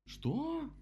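very high, outraged squeak; indignant. A narrator's reading. Rabbit objects to the dam, indignant. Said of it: "возмутился кролик".

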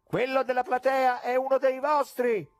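doubting heckler; heckler from the gallery. A narrator's reading. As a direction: scoff from the back rows.

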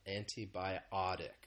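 antibiotic said slowly, with the stress landing on "bi"; slow model word, stressing its fourth syllable. Shown as a demonstration. Teacher models "antibiotic" for English learners, stress on its fourth syllable. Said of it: "The stress is on that fourth syllable".

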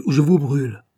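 loud armed threat; cried threat. A narrator's reading. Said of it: "cria-t-elle".

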